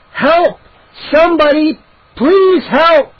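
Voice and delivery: high, loud panicked cry; screaming for help. A narrator's reading. Trapped boy screaming up to the ceiling hole in panic. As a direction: yell up at the hole in terror. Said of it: "I screamed as loud as I could".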